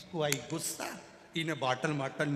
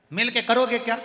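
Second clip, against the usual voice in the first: shouting grandson; 8 semitones higher, 9 dB louder than usual. Retold or imitated. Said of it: "नाना चिल्ला कर बोला".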